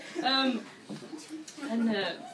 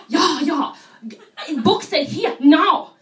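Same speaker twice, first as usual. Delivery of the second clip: louder, insistent tourist; broken English; 4 semitones higher, 6 dB louder, slower than usual. Retold or imitated. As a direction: tourist insists on her guidebook. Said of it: "And she says".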